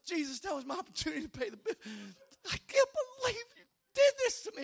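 mock tearful whine, rushed and climbing high; heartsick whining. Preacher complaining to Jesus over lost bill money, mock crying. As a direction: wail it fast as if about to cry. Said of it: "and my heart sank".

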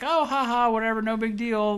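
high, loud, jokey brush-off; mock breezy dismissal. Host imitating the film's casual attitude, mock breezy dismissal.

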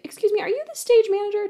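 high, quick mock-polite question; imitated polite query. Colleague on stage asking a stranger, imitated high and quick. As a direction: put on a high, hurried asking voice.

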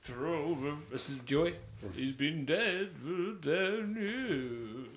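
put-on slow drawling old cowboy voice; lightly put-on slow old cowboy. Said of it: "Why do you sound like an old cowboy there?".

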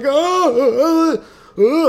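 very high, loud howls; hammed-up agonized cries; mock wailing in pain. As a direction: pretend your kneecap just snapped. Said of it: "He was like".

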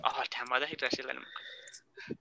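whispered self-scolding aside. Real, not acted. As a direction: hiss it to yourself, embarrassed. Embarrassed at own wrong guess.

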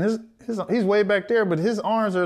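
usual speaking voice

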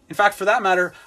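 ordinary delivery

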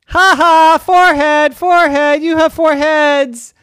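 very loud, high triumphant taunt; gloating laugh. Acted. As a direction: crow over the pun. Girl gloating over joke.

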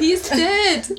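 high, sharp exclamation; exclaiming. Acted. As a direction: blurt it out in shock. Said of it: "exclaimed Nicole".

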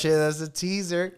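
high, loud, excited reaction; playful hype. Real, not acted. Guest hypes the promised part two, excited. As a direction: pitch it up loud like a teaser.

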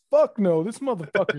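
retold outburst, loud and high-pitched; emphatic refusal. Guard refusing to take him to the office, loud retold outrage. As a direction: refuse outright, voice jumping up in mock outrage.

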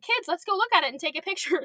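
high, quick, upbeat invitation; eager teacher. Retold or imitated. Teacher inviting kids to look at wildlife, high and eager.

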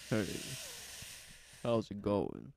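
low breathy come-on; sexy voice. Pretend. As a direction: try a smooth seductive greeting. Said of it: "I'm gonna put on my sexy voice".

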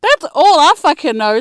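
loud, high sassy retort; mock retort. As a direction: fire it back at them. Snapping back at friends.

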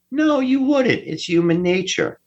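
high, emphatic answer to a rhetorical question; emphatic high-pitched dismissal. Real, not acted. Answering his own question as an imagined employer, high-pitched dismissal. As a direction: voice leaps high to answer himself.

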